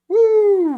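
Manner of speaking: long, high whoop falling at the end; loud whoop. Real, not acted. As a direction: let out a big high "woo". Whoops when moving along.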